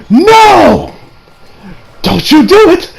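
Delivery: high, loud mock-panicked protest; alarmed warning. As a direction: cry out to forbid it. Acted plea to author.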